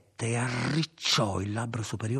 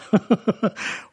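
short, high burst of laughter; brief laugh; 10 semitones higher, 6 dB louder than usual. A narrator's reading. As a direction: give a quick laugh, raised in pitch. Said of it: "fece una breve risata". (breve risata acuta)

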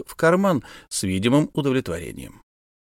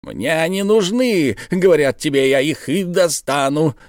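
insistent professor; 6 semitones higher, 3 dB louder than usual. Acted. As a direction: drag out each demand firmly.

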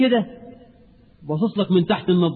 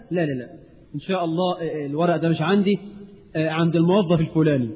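dismissive mumbling brush-off; 5 semitones lower, 5 dB quieter, faster than usual. Acted. Official brushes off citizen.

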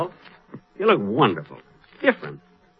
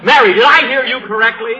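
loud, high, stunned question; raised voice in disbelief; disbelieving; 8 semitones higher, 6 dB louder than usual. Acted. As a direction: ask as if you misheard. Shocked at Dennis news.

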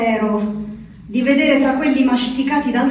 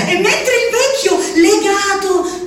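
normal talking voice

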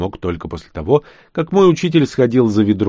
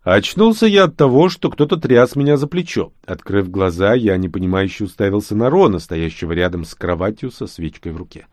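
ordinary delivery, nothing marked

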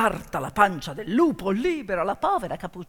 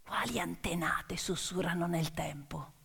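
hushed whispered questions; mysterious whisper; 4 semitones lower, 11 dB quieter than usual. Acted. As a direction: whisper them like a secret. Closing questions about ancestors.

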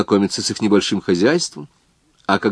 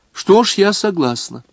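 lightly voiced old woman; 10 semitones higher than usual. Himankori agrees to take girl.

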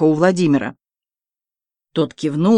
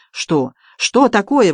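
mildly agitated outburst; excited exclamation; 3 semitones higher, slower than usual. A narrator's reading. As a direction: cry out the question in agitation. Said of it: "возбуждённо вскричал Балабуха".

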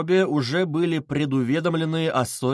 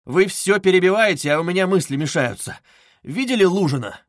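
raised, louder voice; mildly exasperated, demanding their attention; edgy, insistent questioning; irritated complaint; 5 semitones higher, 5 dB louder than usual. A narrator's reading. Raskolnikov's irritated complaint in story.

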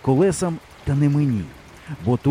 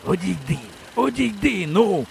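annoyed, effortful wheeze, rising higher on the repeat; irritated strained rasp; 5 semitones higher than usual. A narrator's reading. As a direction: croak it while straining with effort. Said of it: "крекчучи від натуги, роздратовано прохрипів він".

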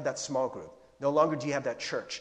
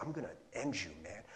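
low, soft menace; understated villainous threat; acted quiet threat; 7 semitones lower, 12 dB quieter, faster than usual. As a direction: play a tormentor, keeping the voice down. Acting Paul's menacing tormentor.

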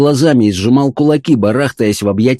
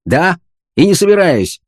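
higher-pitched, resolute refusal; decisive retort; 8 semitones higher than usual. A narrator's reading. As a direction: refuse firmly and crossly. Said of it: "ответствовал фон Хартман решительно".

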